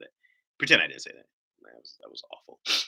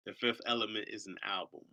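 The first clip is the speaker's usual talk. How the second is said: ordinary talk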